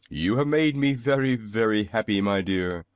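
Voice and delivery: lightly voiced delighted old man; happy exclaim. A narrator's reading. Braided man thanking Dorothy for the ribbon, gently delighted. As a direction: sound touched and pleased. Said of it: "he exclaimed".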